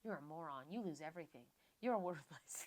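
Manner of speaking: a soft, nasty mock of inner self-hate; harsh inner voice. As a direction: voice the cruel voice in your head under your breath. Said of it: "It's like".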